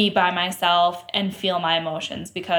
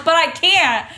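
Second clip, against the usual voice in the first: retold protest, laughing; 4 semitones higher, 5 dB louder than usual. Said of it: "And I'm like".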